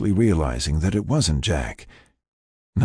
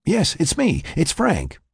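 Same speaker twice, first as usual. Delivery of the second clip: higher-pitched, reassuring reply; Frank; 12 semitones higher than usual. Acted. Frank answers the waking patient, higher reassuring voice. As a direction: answer her, pitched well up.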